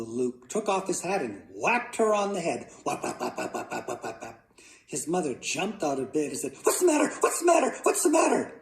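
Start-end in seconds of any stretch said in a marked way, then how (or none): 2.8-4.4 soft, quick chopped sound effect; rapid whacking noises. A narrator's reading. Boy whacking mother with his hat, rapid whacks. 6.6-8.5 very high, rapid-fire squawk; startled outcry. A narrator's reading. Mother whacked awake with a hat, startled outcry.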